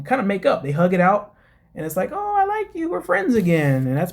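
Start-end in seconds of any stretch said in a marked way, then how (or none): 2.1-3.9 mock sweet making-up voice.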